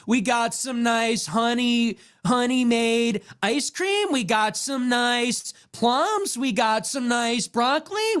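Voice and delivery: high, slow uptalking influencer; mocking uptalk. Comic imitating a grocery influencer's uptalk. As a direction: lilt every item up like a question. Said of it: "up talking".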